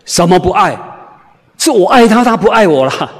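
scoff, then protest she is the one who loves; a wife's mock indignant retort to the preacher; dismissive wife. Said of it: "很不以为然的跟我讲".

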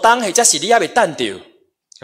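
high, loud preacher's exhortation; forceful preaching. Real, not acted. Preaches with raised voice.

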